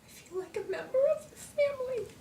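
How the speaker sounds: choked up, voice pitched high; tearful. Real, not acted. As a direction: say it through real tears of belonging. Moved by reunion with family.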